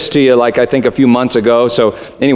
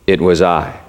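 low, measured admission; grave lion; 7 semitones lower than usual. A narrator's reading. Unseen lion admitting it to the boy, gravely. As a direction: drop low and own it simply.

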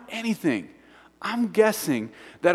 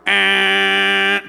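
one long, held air-horn blast; air horn honk; 3 semitones higher, 4 dB louder than usual. Retold or imitated. Camp counselor demonstrating the training week signals, imitated air horn. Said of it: "They get out the air horn".

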